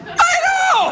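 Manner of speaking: high, bright I know; excited. Real, not acted. Host reacting to India news, high excited. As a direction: squeal it high.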